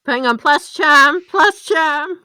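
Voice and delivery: loud, rising excitement; suddenly remember the bonus; excited realization. Real, not acted. Player realizes the roll adds up.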